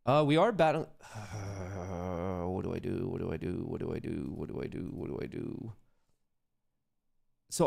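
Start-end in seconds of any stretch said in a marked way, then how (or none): 1.0-5.7 repeats fading down to a whisper; fading fretful mutter. Real, not acted. Trader frets over a trade, fading to a whisper. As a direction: worry it out, getting softer each time.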